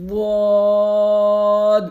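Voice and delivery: full long واد; heavy long Zuad. Shown as a demonstration. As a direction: drag out واد with tongue sides on teeth.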